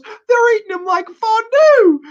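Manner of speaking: put-on shrieking, slow panicked girl; mock panicked scream. Reviewer mocking a girl running out of the torture room, screaming. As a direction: scream it like a hysterical horror victim. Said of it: "she runs out and goes".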